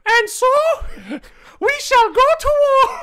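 high thin pirate speech, mocked; squeaky pirate captain. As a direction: declare war in a squeaky voice. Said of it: "Indian guy that's like".

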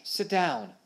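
moderately loud, exasperated shout; shouted order. A narrator's reading. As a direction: order him down sharply. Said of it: "shouted Stash".

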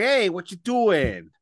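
high, drawn-out New Jersey impression; New Jersey accent. Host showing how the singer really talks, New Jersey accent. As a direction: put on a full-on Yankee Jersey voice. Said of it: "he would talk like this".

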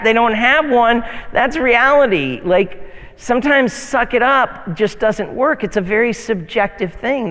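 ordinary talk, nothing marked

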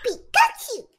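very high squeaky Pikachu cry. Pretend. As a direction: squeal it like the cartoon mouse. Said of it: "please do Pikachu".

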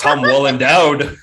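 loud, drawn-out pun through laughter; slow, laughing punchline. Real, not acted. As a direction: crack up while delivering it loudly. Joking pun on actor's name.

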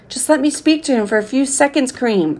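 high-pitched pleading mother; mother begging to speak to her son. A narrator's reading. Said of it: "I heard my mother beg".

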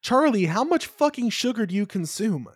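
loud, high-pitched incredulous demand; exasperated outburst; appalled. Real, not acted. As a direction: scold a friend in comic disbelief. Appalled at friend's sugar habit.

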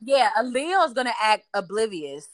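ordinary talk, nothing marked

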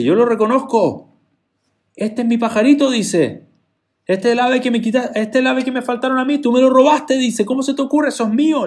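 high, loud accusing cry; outraged owner claiming back his bird; indignant outburst. Acted. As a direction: shout it like a man who just found his stolen pet. Original owner recognizes stolen bird.

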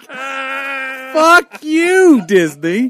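high, loud mock cry of outrage at Disney; mock tearful outburst. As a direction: yell at Disney like you're about to cry, half joking. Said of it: "I start to well up".

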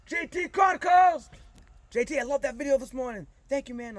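shouted fan greeting across a field; kids yelling. Retold or imitated. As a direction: call it out like an excited kid in the bleachers. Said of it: "they're like".

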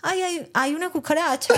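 high, polite little alarm; dainty calm act. Retold or imitated. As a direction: keep it cute and contained. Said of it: "solamente dije".

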